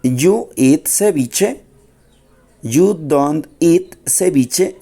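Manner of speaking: slow, spaced example sentences for learners; slow model sentences. Shown as a demonstration. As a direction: dictate them slowly to the class. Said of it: "Miren cómo ha simplemente agregado don't".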